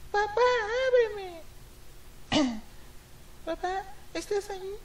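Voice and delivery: put-on high, soft, timid child calling her father; small scared girl knocking at her father's door; little girl voice. Girl softly calling her father through the locked door.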